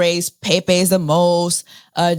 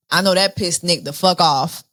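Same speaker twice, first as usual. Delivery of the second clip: ordinary talk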